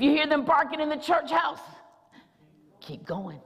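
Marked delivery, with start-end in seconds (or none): 2.8-3.4 hushed refrain. Acted. Dropped to near whisper.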